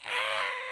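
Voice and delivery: loud imitated shriek of fright; sudden comic yell of terror; mock scream. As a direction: scream as if being blasted at. Retold scream while being blasted.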